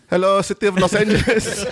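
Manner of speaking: hammed-up translator echo; mock translator. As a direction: repeat it word for word like a useless interpreter. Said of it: "the translator would be like".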